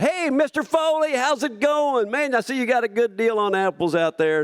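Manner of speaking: bright neighborly hello; friendly stranger. Retold or imitated. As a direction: greet the grocer like an old pal.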